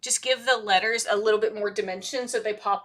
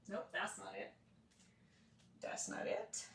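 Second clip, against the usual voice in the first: quiet searching mutter; 17 dB quieter than usual. Real, not acted.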